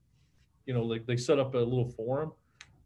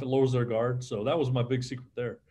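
ordinary talk

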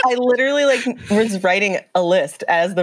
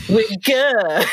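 bad Cockney accent; 4 semitones higher than usual.

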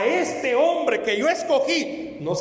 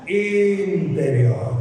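Interior stretched very slowly; slow drawn-out call; 4 semitones lower, much slower than usual. Shown as a demonstration. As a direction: draw it out for the crowd to echo. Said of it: "Otra vez".

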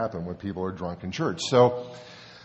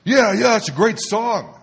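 much higher and louder, eager; imitated delighted drunk; 10 semitones higher, 10 dB louder than usual. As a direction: gush about the song. Joe enthusiastic about song.

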